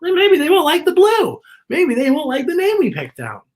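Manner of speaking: high, anxious fretting; a little boy panicking over the baby; worried boy. Acted. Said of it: "and Aiden's worried".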